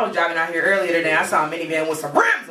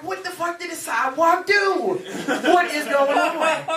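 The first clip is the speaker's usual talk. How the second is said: high, stretched-out incredulous question; mock bewilderment; 8 semitones higher, slower than usual. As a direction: draw it out high in disbelief. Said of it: "looking at him like".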